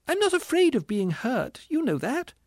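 very high indignant ghost voice; distressed squealing ghost. A narrator's reading. Ghost rebuking the spirit, squealing indignantly. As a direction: plead indignantly, far up high.

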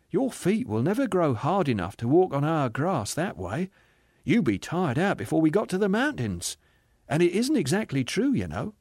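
amused spirit. Acted. Spirit advising the ghost on the journey, gently amused. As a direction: correct him kindly, with light amusement.

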